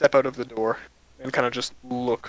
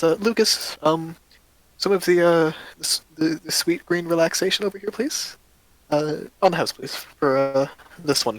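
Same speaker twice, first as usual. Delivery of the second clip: lightly voiced cheerful shopkeeper, a bit faster at the end; friendly shopkeeper; 5 semitones higher than usual. Shopkeeper greeting two adventurers, lightly voiced and friendly. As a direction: greet new customers brightly.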